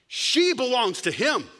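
ordinary talk, nothing marked

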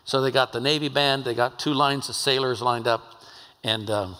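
normal talking voice

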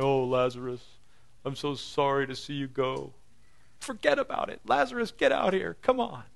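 high, hammed-up mock grief turning to a call; playful Jesus. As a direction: fake sorrow, then summon him out.

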